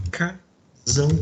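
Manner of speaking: "Casão" drawn out slowly, the ending "-ão" stressed; slow model word. Shown as a demonstration. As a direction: stretch out "Casão" to show the augmentative. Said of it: "A um de aumentativo".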